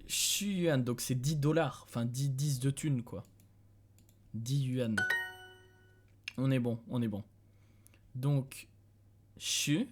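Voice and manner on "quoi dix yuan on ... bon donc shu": usual speaking voice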